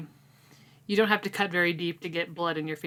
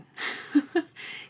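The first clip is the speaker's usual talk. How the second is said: breathy giggle; 11 semitones higher than usual. Real, not acted. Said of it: "I like the dog".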